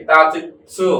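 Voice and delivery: teacher naming the letter; careful pronunciation. Shown as a demonstration. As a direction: announce তাচিৎসু clearly to the class. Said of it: "উচ্চারণটা কি?".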